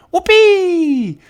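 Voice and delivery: high, slow, stretched whoopee. A narrator's reading. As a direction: whoop with joy. Joyful exclamation.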